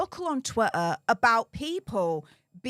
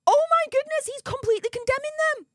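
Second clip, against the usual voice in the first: gleeful shock; 12 semitones higher, much faster than usual. Real, not acted. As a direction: blurt it out in high-pitched scandalized amazement. Mock shock at the revelation.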